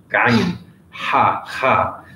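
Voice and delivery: "gayn" with a throaty gh, then "ha" twice as breathy throat h sounds; Arabic sounds demo. Shown as a demonstration. Teacher to Arabic-speaking students, demonstrating "gayn", "ha", "ha". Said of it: "many different difficult sounds like ayn".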